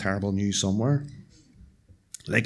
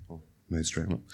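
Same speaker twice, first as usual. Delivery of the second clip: muttered aside; 6 semitones lower, 9 dB quieter than usual. Real, not acted. Aside to audience.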